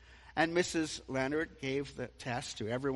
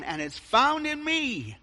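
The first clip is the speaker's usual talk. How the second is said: emphatic; 10 semitones higher, 7 dB louder than usual. Real, not acted. Preacher's climax, repeated line.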